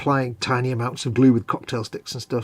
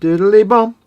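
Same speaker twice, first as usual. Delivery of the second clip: high, loud playful sing-song; loud singsong; 8 semitones higher, 10 dB louder, slower than usual. Acted. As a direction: belt it out playfully. Singsong game.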